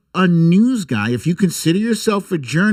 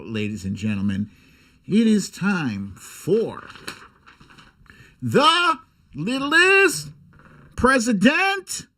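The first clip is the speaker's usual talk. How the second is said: announcer; 4 semitones higher than usual. Acted. Announcing his book.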